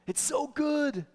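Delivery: high bright praise to a daughter; encouraging praise. Retold or imitated. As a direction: beam it at a child. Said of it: "tell her".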